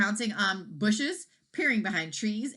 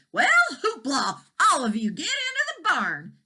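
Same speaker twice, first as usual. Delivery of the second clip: loud, higher herding call; yelling; 10 semitones higher, 7 dB louder than usual. A narrator's reading. Farmer calling cows home, loud call. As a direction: holler it at the cows.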